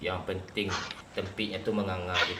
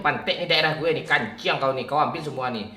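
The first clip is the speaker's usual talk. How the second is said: angry; 4 semitones higher, 7 dB louder than usual. Real, not acted. Shouting at Wahyu in game.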